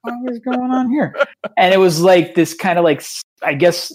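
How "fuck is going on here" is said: high-pitched baffled mock exclamation; startled disbelief. Organizer reacting to fans on the stairs, high baffled exclamation. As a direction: play being caught off guard. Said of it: "And I'm like".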